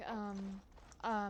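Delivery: ordinary talk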